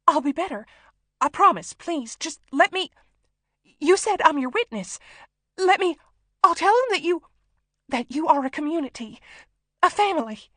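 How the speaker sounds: high, loud, frantic begging; terrified hostage bargaining; pleading. A narrator's reading. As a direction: plead desperately, words tumbling into false starts. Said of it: "She couldn't stop pleading".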